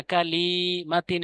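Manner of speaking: কালিমাতিন with long slow vowels and a rise; wrong drawn-out reading. Shown as a demonstration. Teacher demonstrating the drawn vowel to avoid. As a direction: stretch কালিমাতিন out slowly. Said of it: "এবার যেন এরকম টান হয় না".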